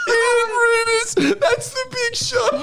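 crying with laughter at the big-shot line; laughing hysterically. Real, not acted. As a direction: barely get it out for laughing. Still laughing, high voice.

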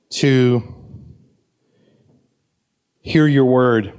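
steady, earnest pastoral prayer. Acted. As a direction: pray over the congregation gently. Closing prayer.

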